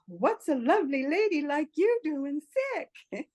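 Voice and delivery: admiring man asking the sick woman; charmed man. Retold or imitated. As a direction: play him sweetly flattering her. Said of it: "And he says".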